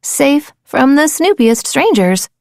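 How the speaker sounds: ordinary delivery, nothing marked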